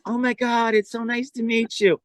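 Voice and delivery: high-pitched mock gushing; starstruck fan. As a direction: imitate a thrilled woman meeting a star. Retelling the starstruck woman's greeting.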